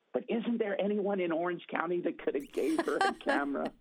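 high, incredulous complaint; rising, disbelieving protest; exasperated plea. Retold or imitated. Speaker complaining to God in his head, high and incredulous.

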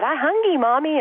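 put-on high little toddler voice calling mama. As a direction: imitate a two-year-old asking for food. Mother voices her toddler daughter.

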